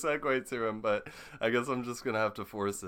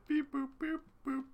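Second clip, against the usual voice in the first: high spoken beep-boop noises; high-pitched beeps and boops; robotic beeping. Pretend. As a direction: imitate a computer working away in high little beeps. Host fills wait with machine noises.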